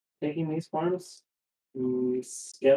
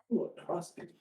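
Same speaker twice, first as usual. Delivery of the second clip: whispered; 4 semitones higher, 8 dB quieter, faster than usual. Real, not acted. Spots a crossbow.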